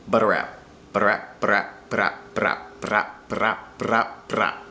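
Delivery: butter wrap sped up until it buzzes into brap; speeding up into rolled r. Shown as a demonstration. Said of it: "with the phrase butter wrap".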